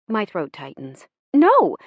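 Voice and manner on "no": high, loud, overbright denial; a forced bright No; too brightly. Retold or imitated. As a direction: blurt it far too cheerfully. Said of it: "I say too brightly".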